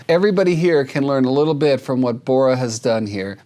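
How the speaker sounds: ordinary talk, nothing marked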